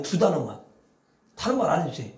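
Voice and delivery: normal talking voice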